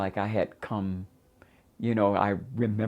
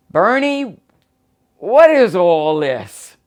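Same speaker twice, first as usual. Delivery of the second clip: high, loud, drawn-out call; mother's loud, high demand; drunk mother's yell; 10 semitones higher, 14 dB louder, slower than usual. Retold or imitated. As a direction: holler it across the room, stretched out. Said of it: "I hear this voice saying".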